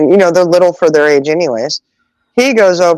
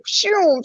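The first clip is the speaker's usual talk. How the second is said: car whoosh; 13 semitones higher, 5 dB quieter than usual. Retold or imitated. Mimicking a speeding car.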